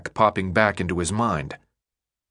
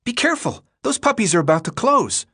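higher, urgent, hurrying after him; urgent warning; 11 semitones higher than usual. Acted. Chuck running after Thomas, urgent warning. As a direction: warn him off, a little breathless. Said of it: "He already sounded out of breath".

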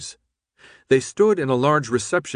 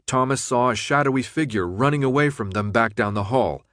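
plain reading voice

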